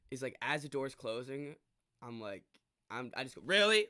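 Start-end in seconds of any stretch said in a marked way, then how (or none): 3.4-3.9 incredulous reproach. Retold or imitated. Acted reproach as door closes.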